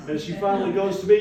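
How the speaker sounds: normal talking voice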